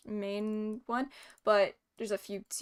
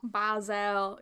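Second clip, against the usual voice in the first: put-on Boston accent. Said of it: "thick Boston accent".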